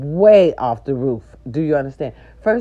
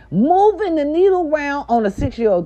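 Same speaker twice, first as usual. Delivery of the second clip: grandma's outrage; 10 semitones higher, 4 dB louder than usual. Retold or imitated. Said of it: "she was like".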